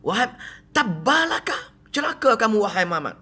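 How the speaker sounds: mock angry Abu Lahab. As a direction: hurl the curse at Muhammad, half-acted. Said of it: "dia kata apa".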